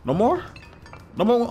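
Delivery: very high rising question; high, teasing taunt; mock disbelief. Real, not acted. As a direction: goad them with a squeaky little question. Taunting opponents who stopped playing.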